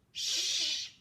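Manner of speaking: a high, hissed "Shh"; sh sound. Shown as a demonstration. Teacher modelling the sh sound. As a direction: hiss out "Shh". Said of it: "making the sound of S H".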